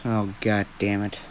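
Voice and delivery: low, soft grumble to oneself; muttered curse. Acted. Deku looks at All Might, a low muttered curse. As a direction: swear quietly under your breath. Deku cursing to himself when confronted.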